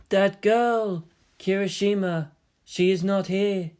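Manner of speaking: a hint of a young woman's voice; figment girl voice. The imagined girl asking about Kirishima, lightly girlish.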